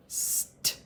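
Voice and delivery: ST as voiceless hiss and tap; long s, then a crisp t, no vowel; sounding out. Shown as a demonstration. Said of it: "Then I heard a t".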